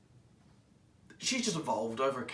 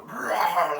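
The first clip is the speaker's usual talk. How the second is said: playful little growl, louder; mock growl; 5 semitones higher, 8 dB louder than usual. Two captains growling at each other in the corridor, mock growl. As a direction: growl like two rivals squaring up. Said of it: "face to face, like".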